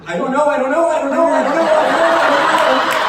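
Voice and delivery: high, loud, frantic chant of fear; panicked mumbling. Retold or imitated. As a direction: babble in rising panic. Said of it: "an echo of a voice".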